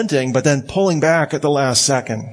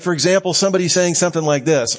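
ordinary talk, nothing marked

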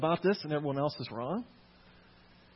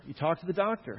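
normal talking voice

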